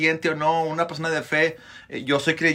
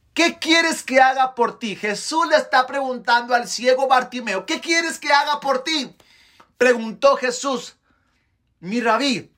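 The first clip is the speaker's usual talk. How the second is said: high, ringing scripture proclamation; impassioned preacher delivering the story; full-force preaching; 9 semitones higher, 3 dB louder than usual. A narrator's reading. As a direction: proclaim each phrase with raised, forceful pitch.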